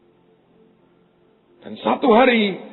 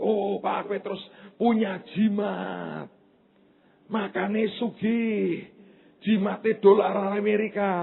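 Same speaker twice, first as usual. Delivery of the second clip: high, slow put-on voice of a scoffing neighbour; mocking gossip voice; 7 semitones higher, much slower than usual. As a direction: drag out the jab in a Javanese-tinged gossip drawl. Mocking gossip about a rich man. (suara menyindir tinggi dan lambat)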